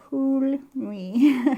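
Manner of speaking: talking around a pen held in the mouth; words muffled by a pen between the lips. Real, not acted. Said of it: "I had the pen in my mouth".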